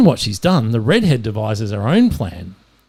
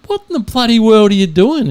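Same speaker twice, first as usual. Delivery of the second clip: high-pitched mock outburst; mock baffled yelling; 8 semitones higher, 4 dB louder than usual. Cannibal leader yelling at the American, mock baffled yell. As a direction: yell it in exasperation, playfully. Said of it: "the cannibal leader's yelling at him".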